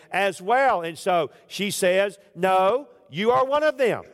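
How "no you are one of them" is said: accusing shout. Acted. Servant girl accusing Peter.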